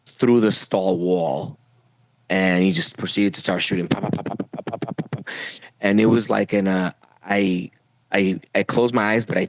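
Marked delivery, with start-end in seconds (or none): 3.9-5.3 soft popping gunshot noises; hushed imitation of repeated shots; imitated gunfire. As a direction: puff out a rapid run of quiet pops.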